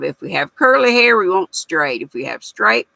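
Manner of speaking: ordinary talk, nothing marked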